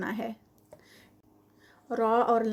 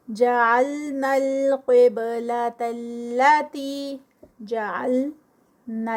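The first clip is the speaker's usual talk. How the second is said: very slow, louder chanted recitation; Quranic recitation; 4 dB louder, much slower than usual. Shown as a demonstration. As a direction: recite it slowly and carefully, each letter clear. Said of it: "ٹو counts کا stretch نہیں ہوگا". (آہستہ اور بلند تجوید والی تلاوت)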